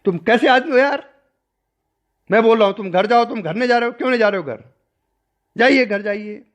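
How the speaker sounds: exasperated teacher yelling at a lingering disciple; loud scolding. Retold or imitated. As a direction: scold him hard to send him home. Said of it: "बहुत जोर से मुझे डांटा".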